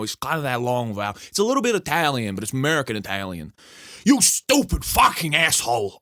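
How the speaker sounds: angry Boston tough guy voice; Boston accent. Acted. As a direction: rant like an Italian American in Boston. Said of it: "nail down my Boston accent".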